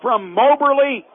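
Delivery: loud, high-pitched call that surges on the interception; excited play-by-play. Real, not acted. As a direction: call the turnover at full voice, stretching the big moments. Calls an interception live.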